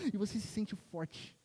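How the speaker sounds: ordinary talk, nothing marked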